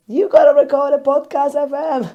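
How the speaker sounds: high, loud nagging VA; bossy assistant voice. Retold or imitated. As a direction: push her to record, insistent. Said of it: "she's like".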